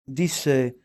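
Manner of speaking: usual speaking voice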